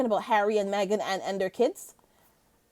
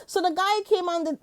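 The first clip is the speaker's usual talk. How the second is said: ordinary delivery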